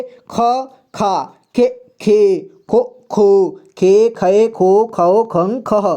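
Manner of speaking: "ख, खा" onward spoken apart, short vowel then long; clear syllable recitation. Shown as a demonstration. Teacher shows how the next row reads, "ख" to "खह" syllable by syllable. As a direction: recite each syllable from "ख" to "खह" distinctly. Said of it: "नीचे को कैसे पढ़ेंगे देखिए".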